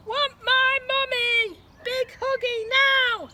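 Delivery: put-on very high, loud, slow toddler wail; screaming baby. A narrator's reading. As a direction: squeal like a baby wanting mummy. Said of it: "screamed the baby".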